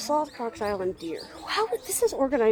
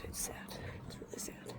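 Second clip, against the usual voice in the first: hushed sad; 19 dB quieter than usual. Real, not acted. Sad caged zoo animals.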